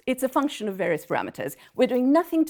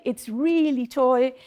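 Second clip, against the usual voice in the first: It's really toy, with toy slowed and leaned on; stress on toy; 2 semitones higher, 3 dB louder, slower than usual. Shown as a demonstration. Lecturer to mathematicians stressing how simple the model is, slow emphatic toy. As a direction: draw out toy to show the model is basic. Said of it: "I should emphasize the word toy".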